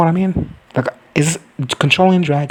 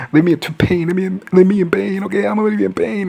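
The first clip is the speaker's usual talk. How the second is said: rising high, melodramatic whine; mock whining; 8 semitones higher than usual. Patient refusing milk of the poppy, mock whining. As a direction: whine more and more like a suffering patient.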